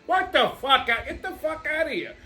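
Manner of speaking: high-pitched, incredulous outburst; exasperated rant. Retold or imitated. Customer fuming over what an oil change costs, exasperated.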